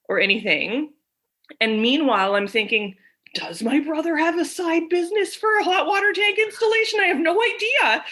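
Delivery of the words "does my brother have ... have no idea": much higher-pitched, baffled wondering; incredulous inner thought. Retold or imitated. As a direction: push it up high in mock bewilderment. Acts out her own baffled thought.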